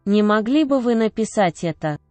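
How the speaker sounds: ordinary delivery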